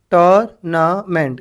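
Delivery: Tournament slowly in three separate beats; syllable by syllable. Shown as a demonstration. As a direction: break tournament into tour, na, ment. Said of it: "कितने syllables".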